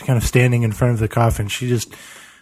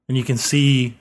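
ordinary talk

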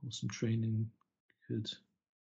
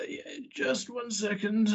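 put-on higher, slow old man voice; elderly voice; 9 semitones higher, 6 dB louder than usual. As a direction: speak it slowly like a frail old man. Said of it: "a fairly elderly voice says".

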